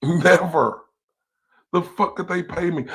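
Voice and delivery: ordinary delivery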